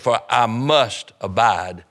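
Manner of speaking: slow, deliberate weight on "must"; stress on must. Shown as a demonstration. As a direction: lean hard on "must", drawing the phrase out. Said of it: "I emphasize that, underline that".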